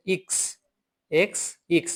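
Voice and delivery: ইক্স with an ee vowel, not এক্স; German X. Shown as a demonstration. Teacher comparing the English and German X. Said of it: "ইংরেজিতে বলেছিলাম এক্স".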